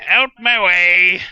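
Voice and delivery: loud, slow bellow; shouting. Pretend. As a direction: clear the street with a shout. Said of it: "going through the streets like".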